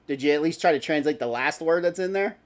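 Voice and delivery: ordinary talk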